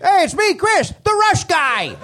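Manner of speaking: a pleased nerd announcing himself; hammed-up eager fan; mocking the fan. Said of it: "made him think".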